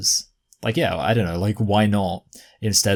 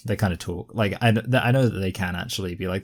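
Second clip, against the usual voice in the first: ordinary delivery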